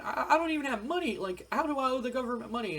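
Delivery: high-pitched, hurried disbelief; acted-out bewildered outburst; mock panicked protest. Retold wrestler protests tax bill.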